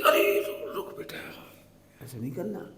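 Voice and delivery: soft, tender mother-in-law; quiet, affectionate warning; lovingly. Retold or imitated. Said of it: "इतने प्यार से ही बोलती".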